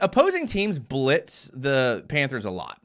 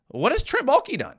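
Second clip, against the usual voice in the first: fired up; 10 semitones higher than usual. Real, not acted. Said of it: "I'm getting fired up here".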